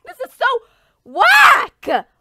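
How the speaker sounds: high cracking shout; exasperated yell; frustrated wail. Real, not acted. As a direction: wail at the unfairness. Frustrated outburst.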